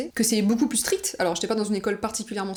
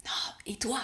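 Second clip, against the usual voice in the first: much softer, voice pulled back; hushed reply; 9 dB quieter than usual. Retold or imitated. New girl answering the classmate in class, hushed. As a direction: answer under your breath.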